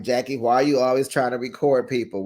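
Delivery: ordinary talk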